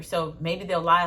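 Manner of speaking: normal talking voice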